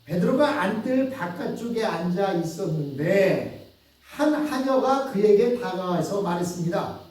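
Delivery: ordinary delivery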